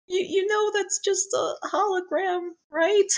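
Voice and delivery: high, soft, tentative voice; worried children. Acted. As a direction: voice concerned kids, small and careful.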